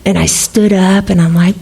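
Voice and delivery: ordinary talk, nothing marked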